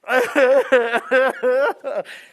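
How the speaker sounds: long, loud, high peal of laughter; real uncontrollable cackling; helpless laugh. Real, not acted. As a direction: laugh till out of control. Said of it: "I haven't laughed like that for ages".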